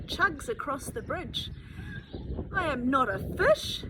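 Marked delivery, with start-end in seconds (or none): 2.5-3.8 loud proud truck voice calling out; old truck shouting to the river; joyful shout. A narrator's reading. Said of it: "he shouts to the river".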